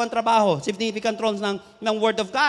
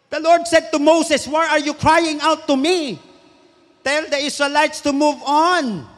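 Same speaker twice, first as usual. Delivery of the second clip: slow, raised, emphatic declaration; commanding scripture reading; 4 semitones higher, 6 dB louder, slower than usual. Acted. Preacher reads God's command to Moses, loud and commanding. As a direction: proclaim it like God's order to Moses.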